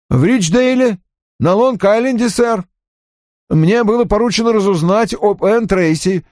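high, eager-to-justify report; offended young subordinate reporting. Acted. Voices Johnny reporting to his boss. (высоким голосом обиженного подчинённого)

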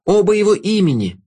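high crowd cry, stress on "Оба"; crowd shouting. A narrator's reading. Crowd cries out.

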